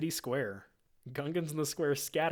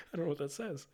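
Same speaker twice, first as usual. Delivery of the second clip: laughing aside; 4 semitones higher, faster than usual. Real, not acted. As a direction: say it with a laugh, amused.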